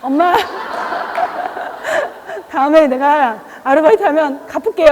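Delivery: quoting the son through laughter; laughing son quote. Retold or imitated. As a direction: chuckle while voicing the son. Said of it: "우리 아들 하트 보내면서". (웃으며 아들 말 흉내)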